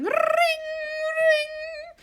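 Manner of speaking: high, ringing bell imitation; bright ding of a bell voiced high. A narrator's reading. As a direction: sing out like a doorbell. The doorbell rings.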